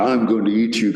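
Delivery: hammed-up monster threat; mock menacing inner voice; creepy voice. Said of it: "And very creepy".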